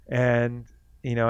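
ordinary talk

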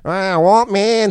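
a mock nasal drawl; nasal landlord impression. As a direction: whine it through the nose like the old landlord. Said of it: "he had a real nasally voice".

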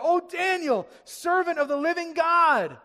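high, loud, acted anguish; anguished cry. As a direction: cry out desperately across to the den. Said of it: "he cried out in a tone of anguish".

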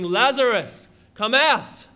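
loud, commanding call to the dead; imitated roaring shout. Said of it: "He shouts".

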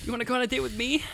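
high-pitched, put-on date invitation; girl from Magnolia. Co-host parodies the Magnolia girl, high put-on voice. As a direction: ask it high, in a movie-girl voice. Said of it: "talk like that girl from Magnolia".

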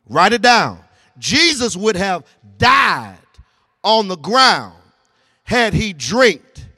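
slow dictation in short, weighted phrases; emphatic preaching. Real, not acted. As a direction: spell out the sermon point, pausing after each phrase. Slow dictation of sermon point.

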